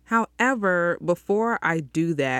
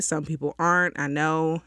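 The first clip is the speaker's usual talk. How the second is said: usual speaking voice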